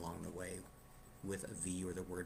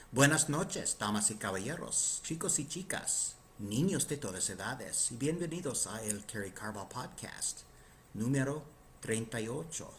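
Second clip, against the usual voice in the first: lucha libre announcer; 3 semitones higher, 3 dB louder than usual. Acted. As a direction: call it out like a wrestling ring announcer. Spanish welcome in announcer style.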